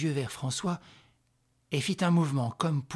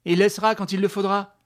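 loud, raised-voice defiance; defiant retort; 7 semitones higher, 8 dB louder than usual. Acted. Woman defies the mother's order, loud and raised. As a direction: throw it back at her loudly.

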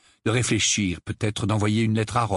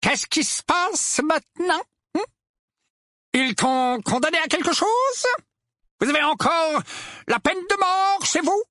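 high-pitched character voice, eager; hopeful, demanding uncle; 3 dB louder than usual. A narrator's reading. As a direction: press for bad news, hopefully. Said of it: "avec espoir". (oncle plein d'espoir, voix très aiguë)

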